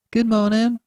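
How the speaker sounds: lightly voiced nurse, pleasant greeting. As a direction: greet him kindly. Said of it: "she remarked, looking up at him pleasantly".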